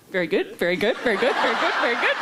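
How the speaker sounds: rising, laughing self-mockery; mock starstruck repetition, breaking toward laughter; retold awkward gushing. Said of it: "And I was like".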